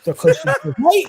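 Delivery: high-pitched, loud hysterical yelp; hammed-up panicked squeal; mock panic. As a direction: shriek it like a sudden awful revelation. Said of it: "I start panicking".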